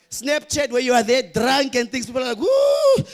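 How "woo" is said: very high, loud whoop; mock whoop. Mourners scrolling funeral photos, a high loud whoop.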